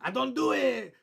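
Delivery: high, loud attempt at an Eastern European cartoon accent; botched Dexter accent. Podcaster quoting Dexter refusing his dad's basketball, botched Eastern European accent. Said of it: "Dexter's Eastern European accent's weird".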